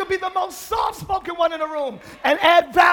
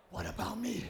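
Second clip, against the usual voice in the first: soft mocking pride voice; 7 semitones lower, 17 dB quieter than usual. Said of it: "Pride always screams".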